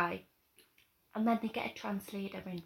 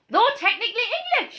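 high, loud, fast mock outrage; incredulous outburst; 12 semitones higher, 9 dB louder, faster than usual. Repeating the translator's line to a friend, incredulous outburst. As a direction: throw the line back in laughing disbelief.

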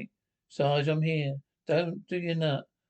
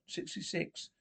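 whispered reply; 10 dB quieter than usual. Acted. Quiet answer over the radio.

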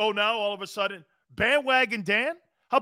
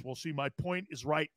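a hint of a stubborn old radio host, low and much softer; mock old radio fart; 4 semitones lower, 10 dB quieter than usual. Host mimics talk-radio old-timers, a lightly voiced stubborn pundit. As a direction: grumble it like a stubborn know-it-all. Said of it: "sit there and go like this".

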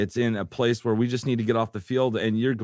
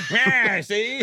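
put-on loud, high old-time gangster quip; old-timey voice; 12 semitones higher, 3 dB louder than usual. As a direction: snap it like a 1930s tough guy. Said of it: "Old-timey voice".

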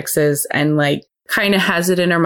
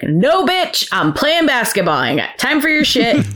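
much higher, harsh dismissal; aggressive brush-off; 8 semitones higher than usual. Retold or imitated. Boyfriend blowing off his needy ex, aggressive. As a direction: cut her off with no patience. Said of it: "Maybe not quite that aggressive".

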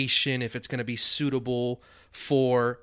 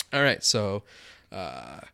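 normal talking voice

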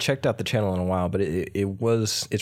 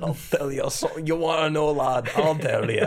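higher mock brogue; Scottish accent; 5 semitones higher than usual. Friend echoing the bit, higher mock brogue. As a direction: echo the threat like a Scot. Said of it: "when I do a Scottish".

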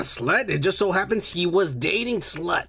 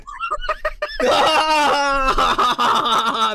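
loud laugh; 7 semitones higher, 3 dB louder than usual. Real, not acted. Big laugh at blowjob joke.

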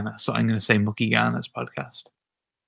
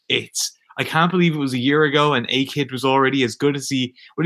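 ordinary delivery

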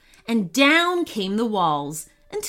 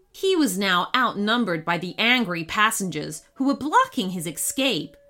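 ordinary delivery, nothing marked

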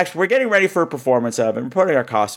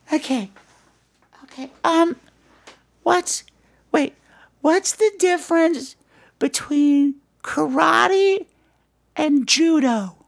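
put-on much higher, slow, sing-song little girl; 14 semitones higher, slower than usual. Katie greets Mister Plummet, a high little-girl voice. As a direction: chirp it like a six-year-old girl. Katie telling her joke.

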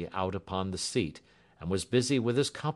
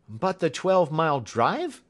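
mild gasp of disbelief, pitched high; taken-aback character voice; startled gasp; 10 semitones higher, 7 dB louder than usual. A narrator's reading. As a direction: protest in loud, higher-pitched surprise. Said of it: "gasped Hatherley".

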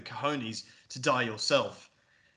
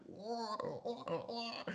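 mock terrible villain laugh, quiet wahs; soft, high imitated laugh; Joker laugh; 9 semitones higher, 11 dB quieter, much slower than usual. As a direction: imitate a silly Penguin-style cackle. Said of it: "like, some terrible laugh".

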